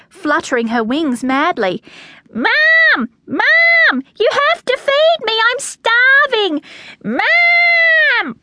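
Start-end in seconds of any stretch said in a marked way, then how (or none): 2.3-8.4 very high, piercing whine for mother; screaming plea. A narrator's reading. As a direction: pester mum in a squealing baby-bird voice. Chick screams after mother for food.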